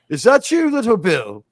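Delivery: high, loud put-on movie gunfighter voice; English Bob. Gunfighter recognizing an old foe's voice, loud imitated greeting. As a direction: pitch it high and loud, then drop on the name. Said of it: "before he turns around, he goes".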